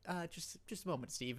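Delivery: soft, confidential murmur; hushed tones. Retold or imitated. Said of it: "you talk to him in hushed tones".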